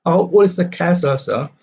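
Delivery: put-on OLD pitched high, "sir" dropping away; stress on OLD. Shown as a demonstration. Pupil's question to the teacher, stress on OLD. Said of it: "So old is stress".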